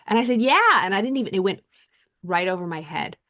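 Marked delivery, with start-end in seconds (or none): 0.4-0.8 high, loud beaming yeah; cheerful yes. Retold or imitated. Naive girl answering Gino, high and loud. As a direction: answer brightly, missing the insult.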